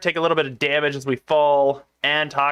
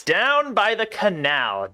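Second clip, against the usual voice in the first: slow and raised in pitch; high, drawn-out reading; 5 semitones higher, slower than usual. Acted. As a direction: stretch it out up high. Line read twice in different pitch.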